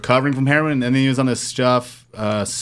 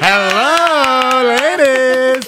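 loud, high, drawn-out hello; showy greeting; 11 semitones higher, 5 dB louder, much slower than usual. Pretend. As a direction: sing out a big entrance greeting. Guest makes his entrance.

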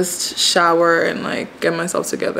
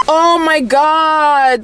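giggling; 10 semitones higher, much slower than usual. Real, not acted. Laughs at closed restaurant.

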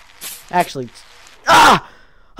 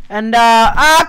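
ordinary delivery

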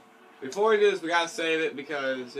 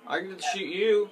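normal talking voice

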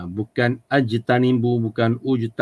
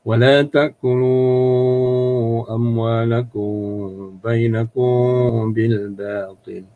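Quran recitation. Shown as a demonstration. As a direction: recite in the Arabic lilt with clear makhraj. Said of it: "Baca mesti begitu".

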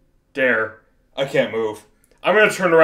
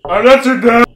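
frightened yell; 6 semitones higher, 8 dB louder than usual. Real, not acted. Yelling at game character.

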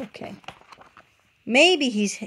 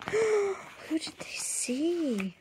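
much softer, very slow after a gasp; mother hushes to build suspense; hushed suspense; 7 semitones higher, 9 dB quieter, much slower than usual. Acted. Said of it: "suspense for child".

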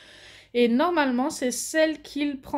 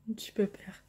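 whispering; 4 semitones lower, 8 dB quieter, faster than usual. Real, not acted. Whispered to the dog.

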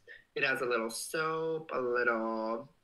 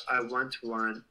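ordinary talk

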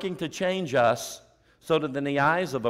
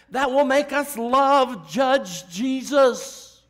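high, loud and slow; preacher's loud slow climax; loud slow emphasis; 10 semitones higher, 7 dB louder, much slower than usual. Real, not acted. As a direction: drive it home, word by word.

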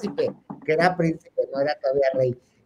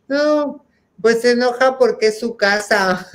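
higher, whining reply; high whiny excuse; 7 semitones higher, 4 dB louder than usual. Retold or imitated. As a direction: whine the excuse. Friend replying on phone.

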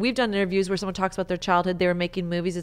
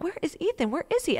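much higher, alarmed mother looking for her son; where did my kid go, pitched way up; frantic searching; 11 semitones higher than usual. Retold or imitated. Mother looks for her son at the game, high and alarmed. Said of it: "and I'm like".

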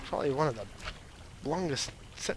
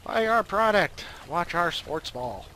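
hammed-up pitchman, louder on buy; mock ad read; 3 semitones higher, 6 dB louder than usual. Fake sponsor pitching to viewers, mock ad. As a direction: sell it like a cheesy commercial.

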